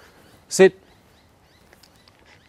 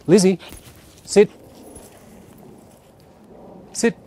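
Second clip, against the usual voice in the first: higher and louder call of the name; calling the dog; 9 semitones higher, 4 dB louder than usual. Real, not acted. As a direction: call her name to get attention.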